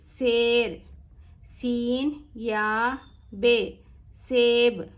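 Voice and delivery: slow, drawn-out words on an even pitch; spelling drill recitation. Shown as a demonstration. Teacher spelling words for learners, slow chanted drill. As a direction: sound out each word loudly, pausing between.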